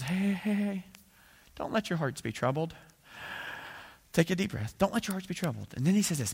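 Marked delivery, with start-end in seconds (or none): 0.0-2.8 tender hushing after an outburst; soothing reassurance. Retold or imitated. Jesus soothing the frightened disciples. 4.1-5.7 tender hushing after an outburst; soothing reassurance. Retold or imitated. Jesus soothing the frightened disciples.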